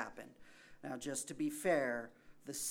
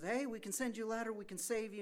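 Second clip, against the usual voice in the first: higher, fast call from a helicopter; shouting down; 9 semitones higher, faster than usual. Retold or imitated. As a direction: call down over the rotor noise. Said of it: "they're shouting down to him".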